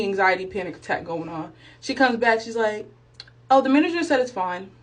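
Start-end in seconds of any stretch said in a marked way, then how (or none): none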